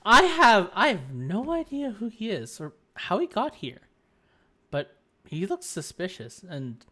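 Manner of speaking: whisper-aside about the stranger; hushed Naruhodo. Acted.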